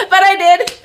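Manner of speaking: high, loud giggles at her own joke; real laughter at her own bit; laughing at herself. Real, not acted. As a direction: crack up and admit it.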